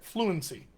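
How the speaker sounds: "Fluency" as "FLU-un-see", middle vowel weak; everyday smushed "Fluency"; reduced. Shown as a demonstration. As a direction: say "Fluency" softly and connected. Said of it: "I just did it".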